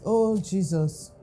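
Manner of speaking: normal talking voice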